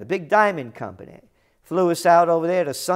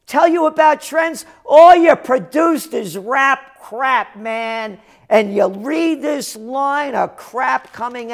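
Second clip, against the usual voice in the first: loud, higher, biting comeback; indignant shout at the rapper; angry retort; 7 semitones higher, 6 dB louder than usual. Real, not acted. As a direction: snap it back at him with contempt. Angry retort to arrogant guy.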